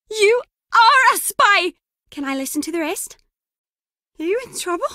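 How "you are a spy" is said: high, loud and drawn out; excited accusation. Acted. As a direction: hit each word in a loud, high exclamation. Excited accusation of being a spy.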